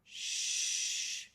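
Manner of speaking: long, unvoiced "Shh"; shushing sound. Shown as a demonstration. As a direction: hush someone with a drawn-out "Shh". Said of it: "just like you're asking somebody to be quiet".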